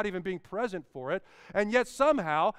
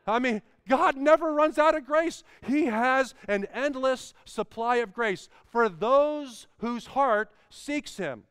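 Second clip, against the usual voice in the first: preaching at full force; 4 semitones higher, 4 dB louder than usual. Acted. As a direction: drive it home to the congregation. Climax of sermon.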